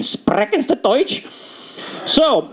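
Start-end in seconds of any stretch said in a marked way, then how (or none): 0.0-1.3 mock Hollywood German accent, higher and slow, rolled R's. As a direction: ham up a movie German officer accent, rolling the R. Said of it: "Hollywood Nazis rolling their R's".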